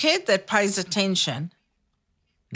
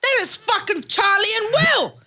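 mock amazed outburst; 10 semitones higher than usual. Pretending to spot Charlie and Will, mock amazed. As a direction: exclaim it like an incredulous superfan. Acting out an excited exclamation.

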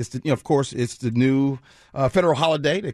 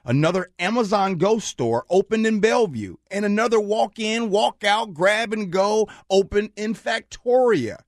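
higher-pitched presenter delivery of a headline; radio news read; 6 semitones higher than usual. Acted. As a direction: announce the next story to listeners. Reads the next news topic.

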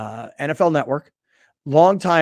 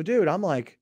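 ordinary talk

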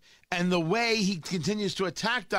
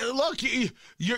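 usual speaking voice

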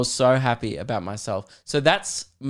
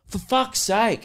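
retold exasperation; 8 semitones higher, slower than usual. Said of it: "he just turned around mid-conversation".

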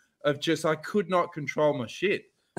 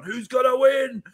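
announcer voice; 7 semitones higher, slower than usual. Retold or imitated. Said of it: "he's gone".

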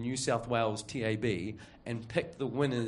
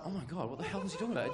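fast, softer, half-laughing; laughing disbelief; 6 semitones higher, 6 dB quieter, faster than usual. Retold or imitated. Employee reacting to boss's example, laughing and fast. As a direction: rush through it, giggling in disbelief.

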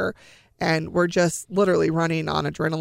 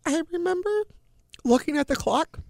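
high, tight voice on the edge of tears; fighting back tears as the memory hits; voice catching; 9 semitones higher than usual. Real, not acted. Mother recalling daughter's diagnosis.

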